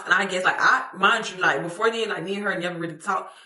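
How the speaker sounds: normal talking voice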